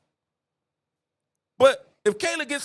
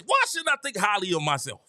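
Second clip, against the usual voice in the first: ordinary talk, nothing marked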